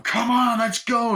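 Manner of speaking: high, loud movie-chase yell; over-the-top shout. Retold or imitated. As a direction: urge the driver on at a shout. Acting out frantic car chase.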